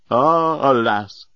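mildly dramatic cry of woe; a touch of grand despair; theatrical lament. A narrator's reading. Great Leovinus cries out to the others, theatrical lament. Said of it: "cried the great Leovinus".

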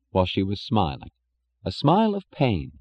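normal talking voice

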